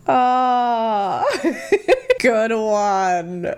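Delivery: hammed-up laughing "good one"; drawn-out mock amusement; fake polite laugh. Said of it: "I'd be like".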